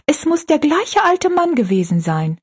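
put-on excited girl, voice lifted high; high, eager exclamation at normal volume; calling out eagerly. A narrator's reading. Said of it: "rief Rosalie".

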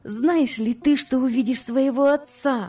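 put-on high-pitched, delighted talk to a child; joyful announcing. Ruth tells little Harry about his father, joyfully. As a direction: tell a little boy happily, stretching the end.

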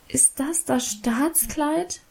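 langsam, höher und leiser, enttäuscht; bitter enttäuscht nachfragen; enttäuscht, vorwurfsvoll. A narrator's reading. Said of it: "fragte ich enttäuscht und vorwurfsvoll". (langsam und leise, enttäuscht vorwurfsvoll)

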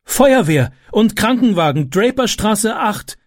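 high, urgent emergency call. A narrator's reading. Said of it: "rief sie".

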